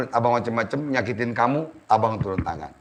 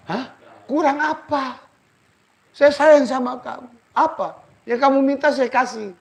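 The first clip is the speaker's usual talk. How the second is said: pleading husband; 10 semitones higher than usual. Acted.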